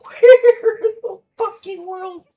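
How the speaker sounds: laughing through the words, slowed; cracking up while asking; laughing disbelief. Real, not acted. Laughing at pudding item.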